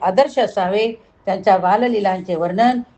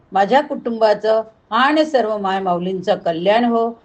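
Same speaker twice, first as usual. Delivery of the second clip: ordinary talk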